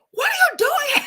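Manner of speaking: high, loud, shrieking disbelief; shocked annoyed squeal. Retold or imitated. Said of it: "I was so annoyed".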